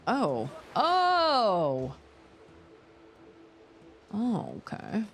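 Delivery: ordinary talk, nothing marked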